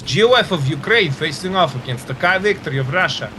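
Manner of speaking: quick commentary in a hammed-up Russian accent; mock Russian accent. Commentator announcing teams to the stream viewers in a mock Russian accent. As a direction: call the teams like a Russian sportscaster, a bit fast. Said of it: "that was delayed terrible accent".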